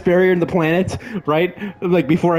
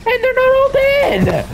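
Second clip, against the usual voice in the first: pitched way up in disbelief; slow, high outraged disbelief; high disbelieving cry; much slower than usual. Real, not acted. Disbelief enemies still alive.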